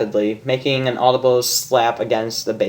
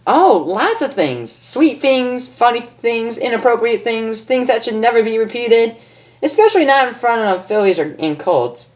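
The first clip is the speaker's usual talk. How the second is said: much higher teasing list; Pinkie Pie voice; 9 semitones higher than usual. Acted. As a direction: tease with glee. Pinkie lists things teasingly.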